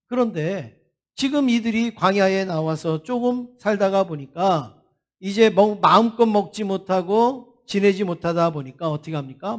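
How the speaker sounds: usual speaking voice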